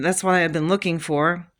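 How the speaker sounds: usual speaking voice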